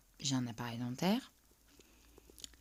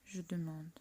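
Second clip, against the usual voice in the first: ordinary delivery, nothing marked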